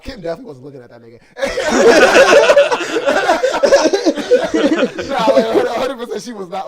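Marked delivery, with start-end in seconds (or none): 1.3-4.0 loud, high-pitched burst of laughter; uproarious laugh. Real, not acted. Cracks up after teasing friend. 4.0-6.7 loud, high, talking through laughter; laughing. Real, not acted. Still laughing at own tease.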